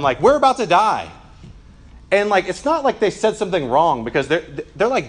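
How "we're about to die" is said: higher, louder cry of panic; mock shout of terrified sailors; hammed-up yell that death is near; panicked yell. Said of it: "yell to him".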